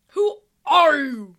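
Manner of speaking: slow, loud commanding boom; army leader's thundering challenge. Acted. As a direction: boom it out across a great distance. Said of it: "a voice boomed".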